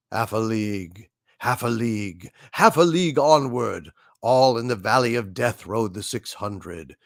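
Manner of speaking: solemn, rhythmic recitation with swells; dramatic poem reading. Shown as a demonstration. Host reading Tennyson for a viewer, solemn and dramatic. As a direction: recite it like a war ballad at a memorial. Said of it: "Any chance of a dramatic reading".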